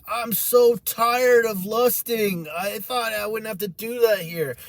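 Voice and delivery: high, drawn-out plaintive complaint; acted-out tearful exasperation; crying lament. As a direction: plead it out as if breaking down. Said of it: "broke down and cried even more and said".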